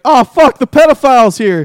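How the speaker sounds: loud, high mock panic; alarmed shout. Hunters spotting the nicknamed monster, mock-panicked shout. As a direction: yell a hammed-up warning to the squad.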